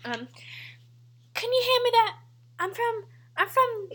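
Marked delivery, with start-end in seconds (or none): 1.3-3.9 higher-pitched attempt at Southern accent. Player starting the pillow line, high Southern accent. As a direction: try a higher Southern belle voice.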